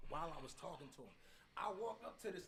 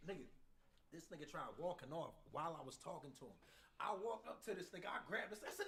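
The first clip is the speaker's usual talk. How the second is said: ordinary delivery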